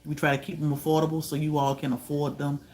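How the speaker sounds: normal talking voice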